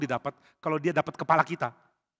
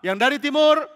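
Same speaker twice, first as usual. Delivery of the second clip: commander calling out; 9 semitones higher, 8 dB louder than usual. Acted.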